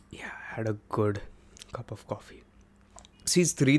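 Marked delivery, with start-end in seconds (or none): 0.1-2.4 hushed breathy whisper, voice off. Real, not acted. Host whispering to listeners about his coffee. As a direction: whisper it like a secret aside.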